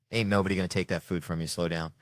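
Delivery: normal talking voice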